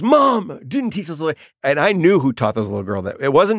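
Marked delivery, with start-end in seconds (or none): 0.0-1.4 put-on boy protesting. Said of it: "And he goes".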